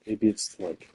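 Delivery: ordinary talk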